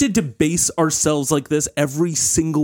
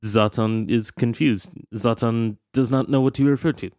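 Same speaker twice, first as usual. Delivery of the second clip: put-on Swedish accent; 4 semitones lower than usual. Zlatan in third person.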